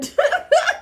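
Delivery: loud, high real laugh; helpless high laugh; high burst of laughter. Real, not acted. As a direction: crack up out loud. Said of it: "Hannah be cracking me up".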